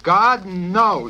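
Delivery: high, loud scream of refusal. A narrator's reading. Yossarian refusing the psychiatrist's bad news, loud scream. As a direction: yell it in horror. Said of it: "screamed Yossarian".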